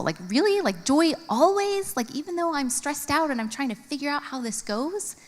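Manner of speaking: a high, disbelieving little squeak; incredulous. Retold or imitated. Stressed mom doubting the verse, incredulous. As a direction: scoff at the verse like it's absurd. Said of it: "it kinda sounded impossible".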